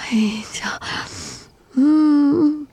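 ordinary talk, nothing marked

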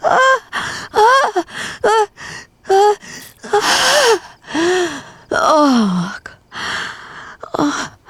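loud, high cries easing off; repeated gasping moans, fading; climactic moans. Acted. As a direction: cry out in pleasure, then gradually subside. Climax of an intimate scene.

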